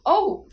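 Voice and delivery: high, loud gasp of oh; sharp shocked exclamation. A narrator's reading. Boy shocked by the dummy's insult, gasped. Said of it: "I gasped".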